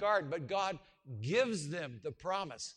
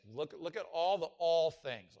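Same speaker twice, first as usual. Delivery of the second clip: normal talking voice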